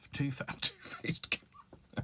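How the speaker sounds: breaking up laughing mid-word. Real, not acted. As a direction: giggle through the words.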